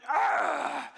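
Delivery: loud mock roar of frustration; angry growl. Little son furious after falling off his bike, angry growl. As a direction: imitate a mad little boy's growl. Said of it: "he was just mad".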